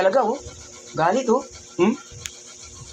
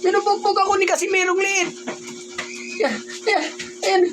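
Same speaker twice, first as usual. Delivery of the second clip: much higher, whiny hammed-up cries of a beaten victim; mock victim; 12 semitones higher than usual. Uncle playing punching bag for the angry little boy: hammed-up victim cries. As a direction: play the helpless victim begging for help, all in fun.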